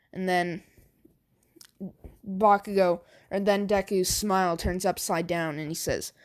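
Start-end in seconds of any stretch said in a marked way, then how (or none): none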